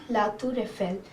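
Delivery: La Tour Eiffel said lah toor eh-FEL; La Tour Eiffel with a rolled French r. Shown as a demonstration. Teacher modelling the French name of the tower. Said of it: "Here's the spelling".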